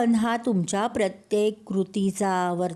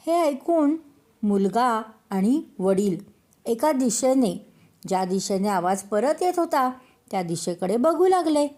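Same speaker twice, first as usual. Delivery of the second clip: usual speaking voice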